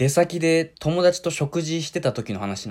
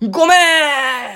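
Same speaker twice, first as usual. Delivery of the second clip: high, loud, drawn-out sorry; loud apology; 11 semitones higher, 7 dB louder, much slower than usual. Retold or imitated. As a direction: bellow the apology at your son. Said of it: "すごい大きい声を出してて。". (息子に大声で謝る)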